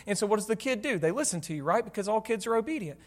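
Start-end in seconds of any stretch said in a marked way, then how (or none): none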